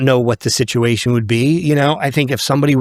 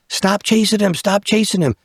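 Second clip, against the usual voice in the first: high-pitched mock yell, no real volume; imitated yelling; 8 semitones higher than usual. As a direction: mimic bystanders calling out, high. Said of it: "these people yelling".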